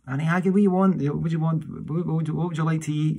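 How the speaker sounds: high, loud little-lady voice, pitched well up; tiny woman's voice. Retold or imitated. Tiny granny asking the children what they want to eat, in a high, loud little voice. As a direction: chirp it up high like a wee tiny woman offering food. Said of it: "and would say".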